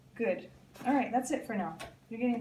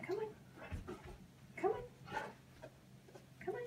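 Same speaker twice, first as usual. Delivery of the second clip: airy high coaxing; 13 semitones higher, 12 dB quieter, slower than usual. Real, not acted. Coaxing puppy, soft high voice.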